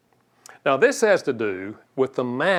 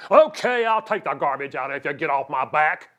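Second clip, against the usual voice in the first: grudging husband. Retold or imitated. Husband grudgingly agreeing to take out garbage. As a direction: give in resentfully. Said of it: "That is not kind".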